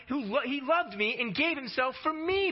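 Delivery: high and fervent, me rising; fervent proclamation. Acted. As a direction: cry it out like good news. Preacher proclaims the verse.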